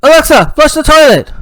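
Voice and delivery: put-on loud, high kid voice; excited child giving a command; eager daughter. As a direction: show off to Daddy, high and loud. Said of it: "She's like".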